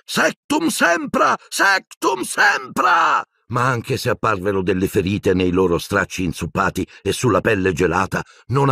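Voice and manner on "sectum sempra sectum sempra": very high, long drawn-out cries; screamed curse. A narrator's reading. Boy slashing at the army of dead, screamed curse. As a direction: scream the spell in desperation. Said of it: "Harry gridò".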